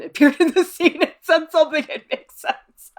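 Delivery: laughing while reading aloud; real giggles breaking through the words; cracking up. Real, not acted. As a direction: read it through rising laughter. Cracks up reading viewer's comment.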